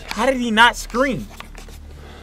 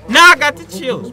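louder, higher spooked repeat; 7 semitones higher, 5 dB louder than usual. Real, not acted. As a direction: blurt it out, creeped out. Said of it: "why does it sound so spooky".

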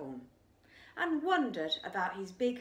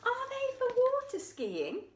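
high, squeaky curious question; squeaky little voice. Acted. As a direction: ask it in a tiny high voice. Character voice, very high.

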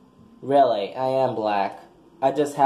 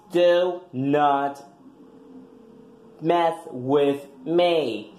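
normal talking voice

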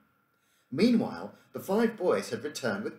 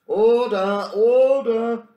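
high loud call for order; 12 semitones higher, 13 dB louder, much slower than usual. Acted. The Professor calling the squabbling boys to order, loud high call. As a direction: bang the gavel with your voice, loud and high. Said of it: "called the Professor".

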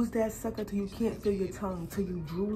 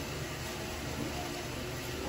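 breathy laugh; 8 dB quieter than usual. Real, not acted. Laughing after the joke.

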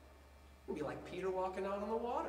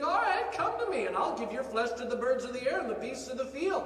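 laughing taunt pitched higher, louder; mocking laugh; 5 semitones higher, 5 dB louder than usual. Philistine laughing as he taunts young David, higher mocking voice. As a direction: sneer through a laugh at the boy.

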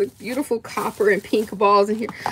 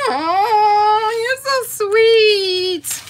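high, drawn-out gushing; touched gushing; 10 semitones higher, much slower than usual. Real, not acted. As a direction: gush in a long high coo. Touched by a gift.

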